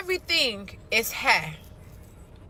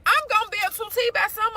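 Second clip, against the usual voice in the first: exasperated joke; 8 semitones higher, faster than usual. Real, not acted. Joking about skipping meals.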